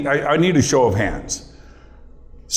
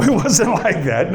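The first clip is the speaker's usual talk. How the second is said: ordinary talk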